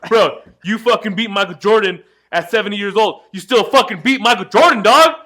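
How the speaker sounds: loud, high, laughing hype; hyped shout. Real, not acted. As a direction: yell it like a fan gone wild. Hyping friend's win over Jordan.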